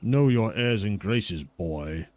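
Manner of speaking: a hint of a stern old lord speaking down; haughty lord. GM voices the lord again.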